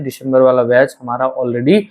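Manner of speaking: normal talking voice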